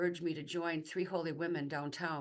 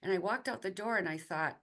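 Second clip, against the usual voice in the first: ordinary talk, nothing marked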